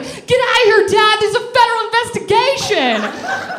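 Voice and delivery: high-pitched, full-volume screech at Dad; hammed-up frantic shout; retold panicked yell. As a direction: yell him out of the room, high and loud.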